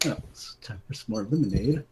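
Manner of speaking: usual speaking voice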